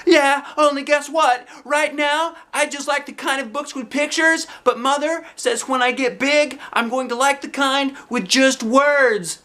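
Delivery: put-on high, eager little girl chatter; bouncy kindergartner explaining herself; child voice. A narrator's reading. Junie B answering the librarian, eager.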